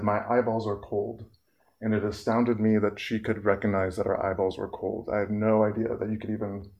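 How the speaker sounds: ordinary talk, nothing marked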